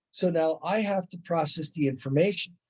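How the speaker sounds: usual speaking voice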